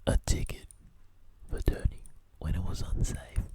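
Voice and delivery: hushed disbelieving whisper. Real, not acted. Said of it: "That's right".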